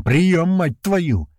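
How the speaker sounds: high, slow, drawn-out shout; angry yell. A narrator's reading. Leader cursing into a silent radio, angry yell. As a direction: yell for a reply, exasperated. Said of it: "снова орёт в рацию Туман".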